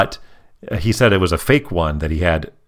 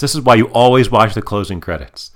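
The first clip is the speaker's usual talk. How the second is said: ordinary talk, nothing marked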